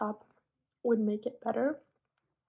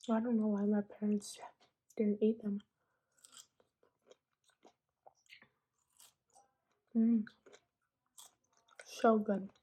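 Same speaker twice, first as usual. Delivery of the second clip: eating while talking; 3 dB louder than usual. Real, not acted. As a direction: mouth full of strawberry. Eating strawberries, savoring.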